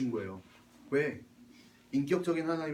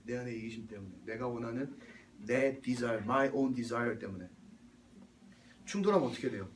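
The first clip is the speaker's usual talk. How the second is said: ordinary delivery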